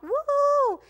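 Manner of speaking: high, loud whoop of joy; cheer. Real, not acted. Vlogger cheering the safe landing in Bangkok, loud high whoop.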